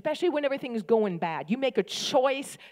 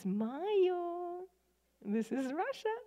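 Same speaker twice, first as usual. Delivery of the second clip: high, softer playful quote, laughing; chirpy put-up-with-it phrase, with a laugh; wry catchphrase; 10 semitones higher, 4 dB quieter than usual. Retold or imitated. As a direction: sing it out as a cheerful shrug. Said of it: "a little phrase that we used".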